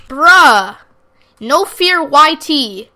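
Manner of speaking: joking shouted threat at a rude player; mock threat. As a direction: yell a fake threat at the kid you don't mean. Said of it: "I'm just kidding".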